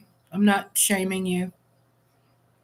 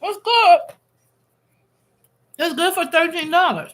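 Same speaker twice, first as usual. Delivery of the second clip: much higher, loud delight; 9 semitones higher, 6 dB louder than usual. Real, not acted. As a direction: burst out with pleasure. Reacting while eating.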